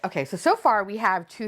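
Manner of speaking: ordinary delivery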